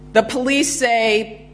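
normal talking voice

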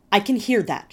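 mother snapping at her son; snapped. A narrator's reading. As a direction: snap back with no patience. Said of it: "she snapped back".

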